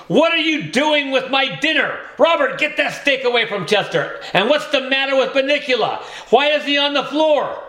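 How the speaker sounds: upset scolding. A narrator's reading. The mother finds her dinner ruined, high fast scolding. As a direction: rattle off the questions in high alarm. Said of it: "Missus Monroe screamed".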